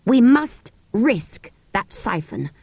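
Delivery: slow and deliberate. Acted. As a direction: plan it out slowly. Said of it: "said Cyril slowly".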